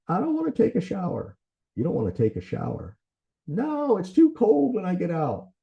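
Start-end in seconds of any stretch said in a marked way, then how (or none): 0.1-1.4 much higher-pitched mock child complaint; imitated little kid. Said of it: "used it with my kids when they were little". 3.5-5.5 very high, protesting mock child voice; imitated little kid. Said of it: "used it with my kids when they were little".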